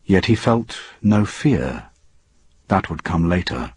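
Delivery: plain reading voice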